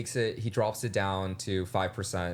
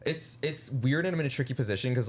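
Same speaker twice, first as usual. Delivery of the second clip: ordinary talk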